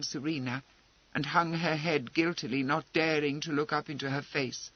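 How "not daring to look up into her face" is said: plain reading voice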